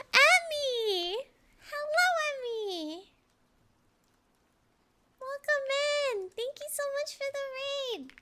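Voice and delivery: high, bright excited welcome; excited greeting. Real, not acted. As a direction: squeal hello to the raider.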